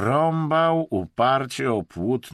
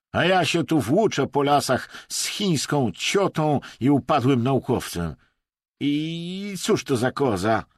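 hysterical rant; 6 semitones higher, 5 dB louder than usual. A narrator's reading. Russian rants at companions.

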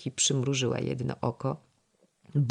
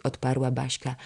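usual speaking voice

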